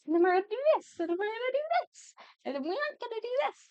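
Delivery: very high squeaky self-parody; hammed-up repetitive planning voice; mock manic chatter. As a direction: babble it in a silly sky-high voice. Imitates own scattered chatter.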